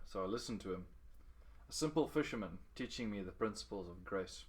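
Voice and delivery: ordinary delivery, nothing marked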